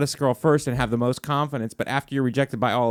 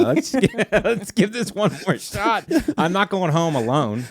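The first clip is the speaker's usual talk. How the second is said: put-on drunk guy voice; 6 semitones higher than usual. Voicing drunk guys at bar.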